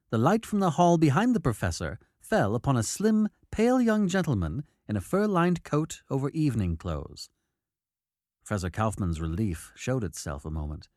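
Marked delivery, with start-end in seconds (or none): none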